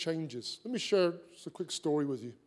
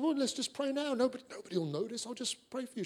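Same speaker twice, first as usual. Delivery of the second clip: softer, higher, conspiratorial coaxing; hushed reassurance; 5 semitones higher, 6 dB quieter, faster than usual. Retold or imitated. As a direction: coax her quietly so no one notices. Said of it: "He said".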